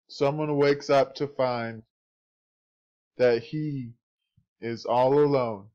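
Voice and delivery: movie trailer narrator. Acted. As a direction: intone it like a film trailer.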